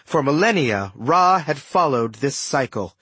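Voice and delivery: usual speaking voice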